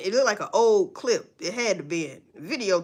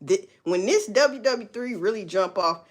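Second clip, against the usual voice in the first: ordinary talk, nothing marked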